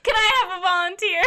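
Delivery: jokey teacher demo voice; mock instructor. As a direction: play a jaunty rope instructor. Mock Fenton teaching class.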